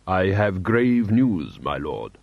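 slow, grave diplomat's voice; tutored foreign prince. A narrator's reading. Envoy brings grave news to a lord, tutored and solemn. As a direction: deliver it slowly, dropping low on the title. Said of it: "registered that the voice was different".

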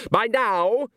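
high, emphatic opening of a complaint; exasperated villain. A narrator's reading. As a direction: lift it high in theatrical impatience.

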